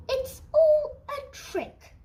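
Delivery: put-on high, loud, laughing child voice; a laughing little monster scoffs; young Gruffalo laughs off the tale; Gruffalo's child. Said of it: "said the Gruffalo's child".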